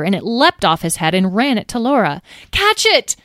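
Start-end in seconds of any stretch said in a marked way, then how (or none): 2.5-3.2 high, loud urgent yell; panicked high-pitched shout. A narrator's reading. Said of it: "yelled Tom".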